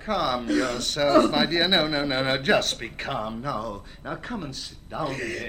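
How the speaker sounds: calming. Acted. Father soothes the frantic mother.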